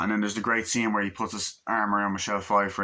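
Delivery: ordinary talk, nothing marked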